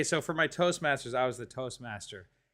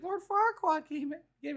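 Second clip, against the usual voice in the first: Pinocchio voice. Retold or imitated. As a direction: squeak it like a wooden puppet. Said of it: "Pinocchio's like".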